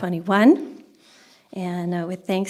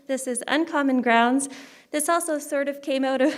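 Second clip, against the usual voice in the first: ordinary delivery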